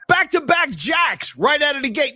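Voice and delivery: fired-up shout; loud sarcastic answer. Real, not acted. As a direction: yell the punchline.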